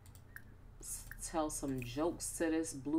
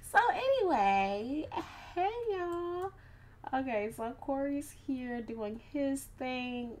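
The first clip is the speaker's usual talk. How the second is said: squeaky silly voice; 9 semitones higher, slower than usual. Acted. Silly high greeting voice.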